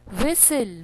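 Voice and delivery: ordinary talk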